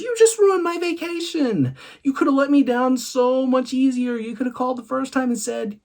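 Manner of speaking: man on vacation protesting the bad news, high and loud; outraged man.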